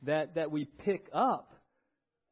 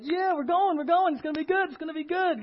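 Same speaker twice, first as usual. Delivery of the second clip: bright, much higher happy voice; eager churchgoer; 11 semitones higher, 6 dB louder than usual. Retold or imitated. Churchgoer cheering on the way to Sunday service, much higher. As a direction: sing out how good it's gonna be.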